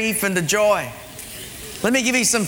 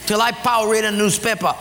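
higher-pitched comic threat; threatening Samoan character; 4 semitones higher than usual. Acted. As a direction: lay down the law in a put-on accent. Samoan threatens the two, joke.

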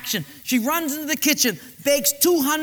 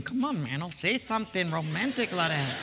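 softer, lower, nudging wife; hushed nudge; 5 semitones lower, 6 dB quieter than usual. Acted. Wife quietly nudging Manuel to be romantic. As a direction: urge him under your breath with a laugh.

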